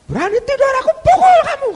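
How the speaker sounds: high, loud furious threat. Retold or imitated. Father threatens his cowering son, screaming. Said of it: "orang ini teriak dengan keras".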